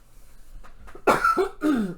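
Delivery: dry raspy cough fit. Real, not acted. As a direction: cough hard, then recover. Cough then excuse me.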